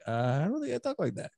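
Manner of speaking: high, a little fast, put-on voice; high mock denial. Dad jokingly denies he talks like that, in a high voice. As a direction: deny it in a silly high voice. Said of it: "And I'm like".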